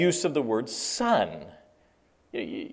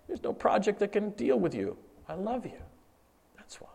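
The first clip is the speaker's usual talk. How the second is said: softer, dropping to a whisper at the end; tender confession; 6 semitones higher, 6 dB quieter than usual. Real, not acted. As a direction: confide your love gently.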